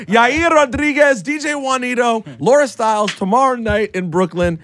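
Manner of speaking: loud, high hype announcer. Acted. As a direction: call the names like a ring announcer. Announcing names over music.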